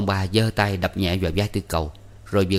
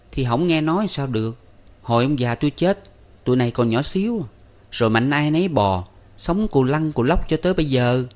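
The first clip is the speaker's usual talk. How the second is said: put-on higher young woman's voice; Con Ba, shrugging; 6 semitones higher than usual. A narrator's reading. Ba tells the guest how they grew up, shrugging it off. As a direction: answer lightly in a raised pitch.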